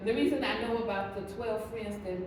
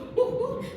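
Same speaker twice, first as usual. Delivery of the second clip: high burst of laughter; 12 semitones higher than usual. Real, not acted. Laugh after the joke.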